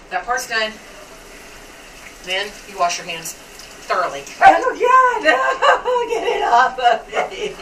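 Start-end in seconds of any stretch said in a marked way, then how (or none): 4.4-7.5 high, loud squeal of disgust with laughter; disgusted laughing shout. Real, not acted. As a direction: shriek it off your hands. Raw ham grossing her out.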